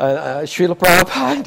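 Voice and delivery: hesitant, halting nervous address; nervous stammer. Retold or imitated. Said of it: "So I kinda stammer".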